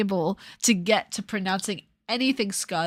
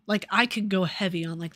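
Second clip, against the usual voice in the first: usual speaking voice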